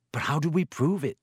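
usual speaking voice

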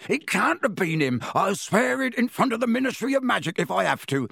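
high, agitated pleading; ranting Hagrid. A narrator's reading. Hagrid pleading with Dumbledore, agitated rant. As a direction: rant on, no room for interruption. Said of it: "Hagrid went ranting on".

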